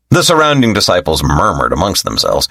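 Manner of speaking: ordinary delivery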